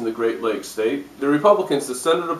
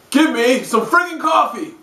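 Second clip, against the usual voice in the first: much higher, slow angry yell; angry shout; 11 semitones higher, 3 dB louder than usual. Acted. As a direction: bellow for coffee. Angry demand.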